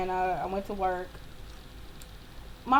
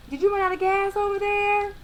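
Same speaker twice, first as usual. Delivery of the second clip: put-on high-pitched, louder old man voice; old dude; 10 semitones higher, 5 dB louder than usual. Old dude calls to stranded driver, high put-on voice. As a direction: call it over as a nosy old man. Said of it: "this old dude was like".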